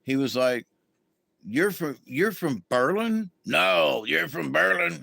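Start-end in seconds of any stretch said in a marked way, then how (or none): 1.4-3.3 lightly voiced sergeant, asking higher; sergeant's way of talking. Sergeant asking the new inmate where she's from, lightly imitated with a rising question. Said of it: "He talked like this all the time". 3.4-5.0 lightly voiced sergeant, settling it; a touch of the sergeant's firm correction; sergeant's way of talking. Sergeant correcting the inmate about her hometown, lightly imitated as a flat statement. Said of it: "He talked like this all the time".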